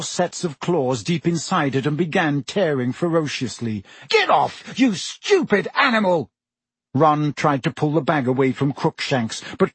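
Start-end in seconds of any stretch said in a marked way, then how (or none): 4.1-6.3 high, angry yell at an animal; angry roar. A narrator's reading. Ron fighting the cat off his bag, high angry yell. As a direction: roar it higher, in contained outrage. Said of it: "Ron roared".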